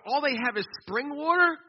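high, loud mock dismay; imitated whining. Mission travelers complain about the water, mock whining. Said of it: "people are whining at me".

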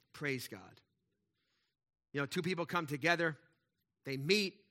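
normal talking voice

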